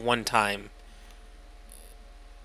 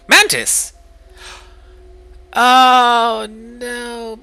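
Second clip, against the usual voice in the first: surprised; 13 semitones higher, 15 dB louder, much slower than usual. Real, not acted. Excited then dismayed.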